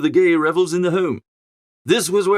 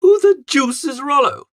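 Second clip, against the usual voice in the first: higher, louder exasperated outburst; alarmed shout; 8 semitones higher, 4 dB louder than usual. A narrator's reading. Young gentleman, shaken by the dog, shouting at his valet. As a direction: shout it, rattled and indignant.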